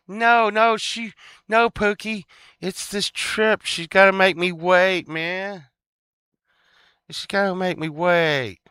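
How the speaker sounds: put-on high, drawn-out childish protest; mock whining. Complaining to Pookie about being made to wait, mock whining. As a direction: whine like a kid made to wait.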